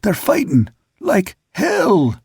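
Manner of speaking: high, slow, breathless cry; high-pitched winded call from aloft; shouted in gasps. A narrator's reading. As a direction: force it out between gasps. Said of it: "shouted out all at once and in gasps".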